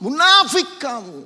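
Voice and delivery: high, loud rebuke; Jesus rebuking the Pharisees, loud and high. A narrator's reading. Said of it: "suaranya meninggi dan menegur".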